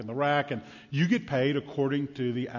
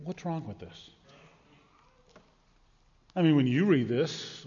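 usual speaking voice

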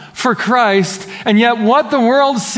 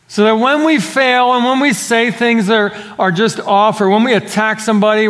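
raised, urgent pulpit voice; fervent preaching; 5 semitones higher than usual. Acted. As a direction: press the congregation hard. Preaches at full force.

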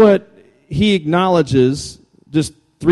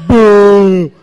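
mock boo; 3 semitones higher, 8 dB louder than usual. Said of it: "And then the guys are like".

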